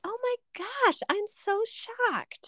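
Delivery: high, slow, hammed-up surprise; mock shock. Friend hearing she loves pimento cheese, hammed-up high surprise. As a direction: play up being stunned.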